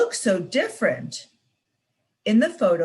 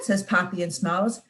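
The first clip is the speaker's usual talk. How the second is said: plain reading voice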